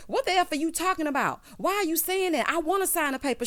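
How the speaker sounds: mildly hammed-up, high-pitched protest; indignant protest. Husband protesting he wants to sign, retold in a high indignant voice.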